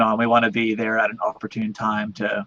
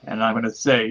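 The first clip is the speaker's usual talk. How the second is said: usual speaking voice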